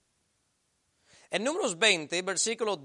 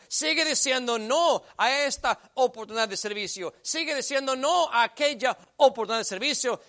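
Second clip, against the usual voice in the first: much louder, higher fiery warning; preaching at full force; 6 semitones higher, 7 dB louder than usual. Acted. Pastor warning the stubborn believer, fiery preaching. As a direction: hammer the warning home.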